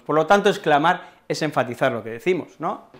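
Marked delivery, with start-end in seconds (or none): none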